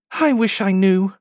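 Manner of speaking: high, small wishing voice; wistful Alice. Acted. Poor Alice who has forgotten her name, wistful. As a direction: wish it helplessly in a high girlish voice.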